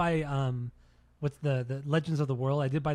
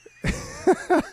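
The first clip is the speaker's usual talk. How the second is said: loud, high-pitched real laugh; burst of laughter; 5 dB louder than usual. Real, not acted. Co-host cracks up at the repeated "initial impressions", bursting into high laughter.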